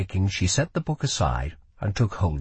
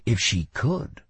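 usual speaking voice

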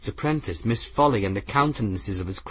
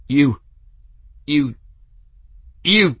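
sputtering anger; 5 semitones higher, 5 dB louder than usual. Acted. Captain sputtering with fury.

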